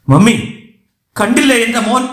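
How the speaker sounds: usual speaking voice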